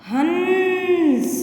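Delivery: हंस stretched very slowly, with the vowel ringing through the nose; drawn-out nasal हंस. Shown as a demonstration. Teacher showing pupils the nasal sound in हंस. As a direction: hold हंस long so the nasal hum is heard. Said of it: "इसका उच्चारण नाक से".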